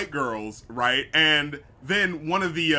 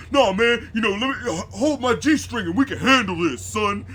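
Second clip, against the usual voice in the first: loud, much higher, hammed-up street tough; put-on tough guy voice; 6 semitones higher, 5 dB louder than usual. Podcaster mocking the brother answering a catcaller, loud hammed-up tough. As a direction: call out the catcaller like a swaggering tough. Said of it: "still having, like, the deep voice".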